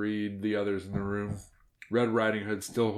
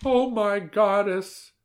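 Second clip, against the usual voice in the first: put-on old woman voice; 11 semitones higher, 6 dB louder, much slower than usual. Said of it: "murmured the old woman".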